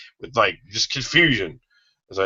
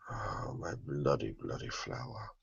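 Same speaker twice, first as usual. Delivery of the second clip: breathy swoon; 7 semitones lower, 20 dB quieter than usual. Acted. Vampire swoons over Flower's name.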